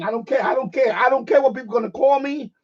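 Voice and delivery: ordinary talk, nothing marked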